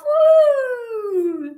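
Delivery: high whooping cheer at blast-off; excited cheer. Acted. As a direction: celebrate the launch.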